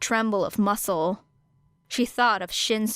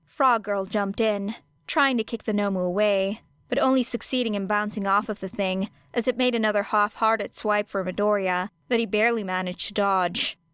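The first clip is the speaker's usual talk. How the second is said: ordinary delivery, nothing marked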